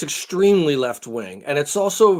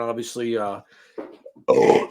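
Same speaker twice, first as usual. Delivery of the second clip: burp slipping out mid-sentence; 3 semitones lower than usual. Real, not acted. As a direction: talk through a soda burp. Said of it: "sorry with all the burping".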